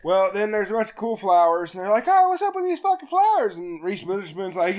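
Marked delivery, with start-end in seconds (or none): 2.1-3.6 lightly acted, high-pitched bewilderment; mock puzzled question. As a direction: pitch it up, imitating baffled kids. Acted puzzled group quote.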